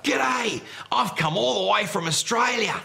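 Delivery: loud, high Aussie "G'day" like Steve Irwin; Steve Irwin impression. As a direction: belt it out like the brash Aussie wildlife guy. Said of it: "And it was like".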